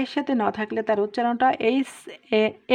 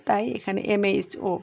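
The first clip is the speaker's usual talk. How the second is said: ordinary delivery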